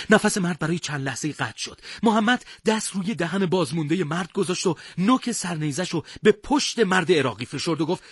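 ordinary delivery

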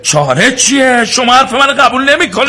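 high, loud indignant retort; angry protest. Acted. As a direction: throw it back at him, outraged. Guest argues with innkeeper.

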